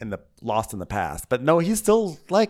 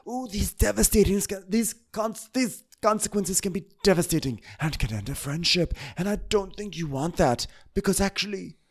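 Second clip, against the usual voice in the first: Lisa; 6 semitones higher, 4 dB quieter than usual. Retold or imitated. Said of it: "So Lisa's like".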